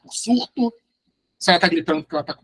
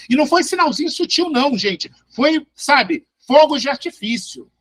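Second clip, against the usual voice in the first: emphatic exclamation; 6 semitones higher, 3 dB louder than usual. Real, not acted. Emphatic exaggeration to class.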